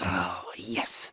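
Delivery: ordinary talk, nothing marked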